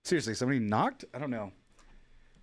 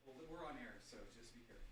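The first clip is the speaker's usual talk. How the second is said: far from the mic, warning; quiet aside away from mic; 25 dB quieter, faster than usual. Real, not acted.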